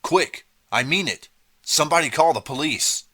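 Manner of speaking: high, loud urgent orders; loud command. A narrator's reading. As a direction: bark the orders at the crowd. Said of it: "Mark commanded".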